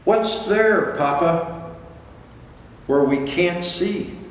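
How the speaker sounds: high wondering little whale; curious child. Acted. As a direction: ask with wide-eyed wonder. Child whale asks about dark.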